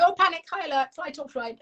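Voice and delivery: high-pitched mock panic; panicked alarm. Larry the lizard raising the alarm, mock panicky. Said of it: "something else will happen. He'll go".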